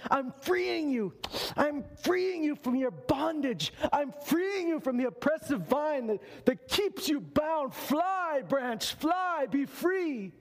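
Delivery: high, slow, hammed-up freeing voice; mock liberator. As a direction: sing it out high and slow like a false saviour. Mocking branch's false liberator.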